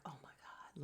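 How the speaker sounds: breathless whispered gasp of words; stunned whisper at a crying scene; shocked whisper. Real, not acted. Shocked at Uncle Clifford crying.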